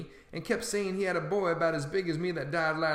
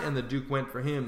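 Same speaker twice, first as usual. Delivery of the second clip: usual speaking voice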